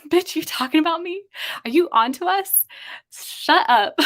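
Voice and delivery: high, sassy bee voice; mock confrontational bee voice. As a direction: voice the bee as a threatened diva. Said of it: "It was flying up like".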